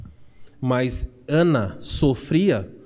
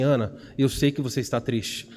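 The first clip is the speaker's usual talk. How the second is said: lower, gentle voice of a comforting husband; tender, subdued sympathy; understanding husband; 5 semitones lower than usual. Retold or imitated. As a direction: reassure a grieving wife, low and unhurried. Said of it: "demonstrar compreensão e falar assim".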